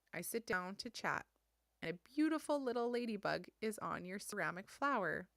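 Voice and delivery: usual speaking voice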